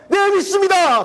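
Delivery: very high, shouted confident answer; eager yes. Pretend. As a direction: burst out with faith. (아주 높게 외치듯 힘차게 대답)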